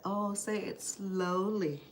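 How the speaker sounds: very slow, drawn-out words; slow request. Retold or imitated. Asking her sister to repeat, said very slowly. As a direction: stretch each word out.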